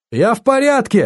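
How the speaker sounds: high, urgent reassurance; acted high-pitched call; calling down the phone. A narrator's reading. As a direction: raise the voice to get through a bad line. Friend on failing phone line.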